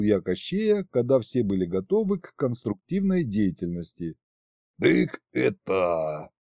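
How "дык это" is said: put-on very slow, low drunken hesitation; tipsy stalling. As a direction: stall like a tipsy king with nothing to say. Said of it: "содержательно начал он".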